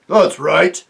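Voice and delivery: loud gruff agreement; chorus of dwarfs; dwarfs agreeing. Acted. The other dwarfs back Griffle, loud and gruff.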